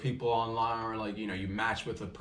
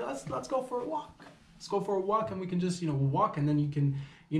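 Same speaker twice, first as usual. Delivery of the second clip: high falsetto, easing down gradually; mock coaxing; 8 semitones higher than usual. Man suggesting a walk to his date, mock falsetto. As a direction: pitch the invitation in a silly high voice.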